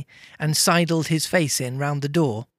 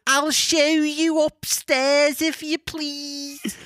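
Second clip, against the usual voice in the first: high, very slow Scouse maid; over-the-top Liverpudlian; 11 semitones higher, much slower than usual. Acted. Maid offers to show Scrooge upstairs, hammed-up Scouse. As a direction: stretch every Liverpool vowel absurdly. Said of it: "a hundred and twenty percent Liverpool".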